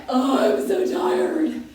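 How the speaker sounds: slow, put-on whiny sigh of exhaustion; mock tired whine. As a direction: drag it out like a worn-out student. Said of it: "we're like".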